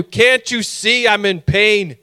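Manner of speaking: high, slow, stretched-out cry of frustration; hammed-up agonized outrage; exasperated retort. Patient answering staff who ask what's wrong, loudly exasperated.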